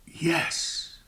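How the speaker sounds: whispered yes; a hushed reply to the congregation. Real, not acted. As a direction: breathe the answer almost silently. Quiet answer after rhetorical question.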